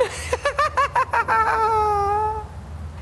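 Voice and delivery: high shrieking evil cackle. Acted. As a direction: maniacal witch laugh. Spooky host cackles.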